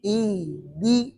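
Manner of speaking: quiet ای، دی with breath and voice kept flowing; soft letter demo. Shown as a demonstration. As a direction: breathe out ای، دی softly with the voice running on. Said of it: "ہا کے اندر آواز جاری ہے".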